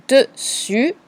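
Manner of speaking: "dessus" enunciated for spelling; dictated word. Shown as a demonstration. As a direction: dictate "dessus" syllable by syllable, rising.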